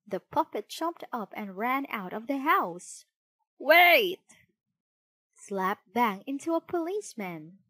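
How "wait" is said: loud, high shout; urgent storybook yell; calling out. A narrator's reading. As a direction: call after someone running off. Geppetto calls after fleeing puppet.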